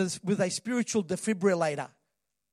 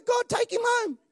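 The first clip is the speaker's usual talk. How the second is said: much higher, laughing fed-up cry; mock exasperated plea. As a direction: groan it like a wife sick of her husband. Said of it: "Then you go".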